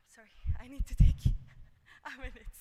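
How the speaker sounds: breathless, with laughing gasps; gasping out the words between breaths. Real, not acted. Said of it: "to catch my breath".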